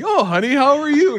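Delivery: high, sweet put-on innocence; playing it cool. Husband greets his wife hiding the tire mess, high fake-casual. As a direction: act breezy, as if nothing happened. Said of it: "I gotta play it cool".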